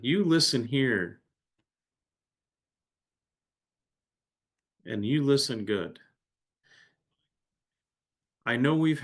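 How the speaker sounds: firm, deliberate scolding with pauses; stern father. Acted. Father tells son he is not disrespecting him, stern. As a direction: lay down the law as an offended father.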